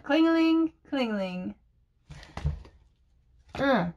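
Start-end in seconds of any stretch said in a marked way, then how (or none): 0.0-1.5 Klingeling bright on the first, dropping softer on the second; German bell sound. Shown as a demonstration. Teaching viewers the German bell word. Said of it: "that's how they spell it".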